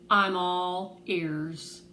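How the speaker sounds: very slow, dry and doubting; skeptical drawl. Acted. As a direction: let her sarcasm sit on every word. Nurse with crossed arms, skeptical.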